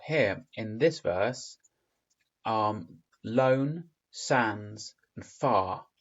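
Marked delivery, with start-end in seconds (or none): 3.2-5.9 "lone", "sands" and "far" each stressed as a separate beat; emphasised words. Shown as a demonstration. Teacher shows which words the rhythm emphasises, stressing "lone", "sands", "far". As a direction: punch "lone", "sands", "far", pausing between them. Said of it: "are all emphasised".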